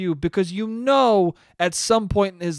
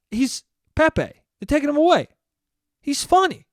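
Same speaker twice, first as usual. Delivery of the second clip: rising higher and louder with each line; mock outrage; 9 semitones higher, 4 dB louder than usual. Old man pleads with the cop, rising mock outrage. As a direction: build a hammed-up, indignant whine.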